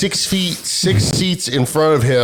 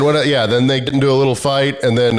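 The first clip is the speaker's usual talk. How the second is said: ordinary talk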